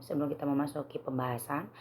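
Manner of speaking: ordinary talk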